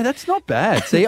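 usual speaking voice

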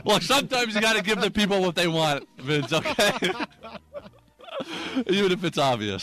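high, laughing self-defense; amused protest. Real, not acted. Comic defending his obvious joke to Vince, laughing protest. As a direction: protest through real laughter.

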